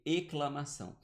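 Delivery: "exclamação" said as "eclamação"; dropped S. Shown as a demonstration. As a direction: leave the S out of "exclamação". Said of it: "eu teria que ler". ("exclamação" lido sem som de S, "eclamação")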